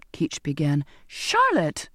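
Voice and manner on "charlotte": cuts in sharp and much higher; angry outburst. A narrator's reading. Girl cutting off the sailor who keeps calling her miss, angry and high. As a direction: snap the name to stop him. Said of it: "I broke in angrily".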